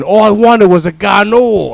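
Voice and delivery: mock-aggrieved holler; loud protest. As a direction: drag it out, loudly pleading innocence. Protesting his innocence in retold incident.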